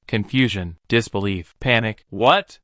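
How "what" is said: usual speaking voice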